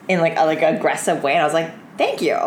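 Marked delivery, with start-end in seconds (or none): 2.0-2.4 high, sweet fake thanks; sarcastic thank you. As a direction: chirp it back brightly.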